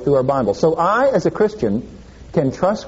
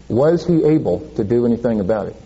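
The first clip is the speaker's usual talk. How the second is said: ordinary talk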